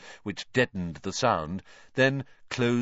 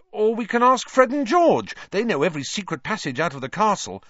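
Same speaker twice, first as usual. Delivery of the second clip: excited; 12 semitones higher, 5 dB louder than usual. A narrator's reading. Ron eager scheme.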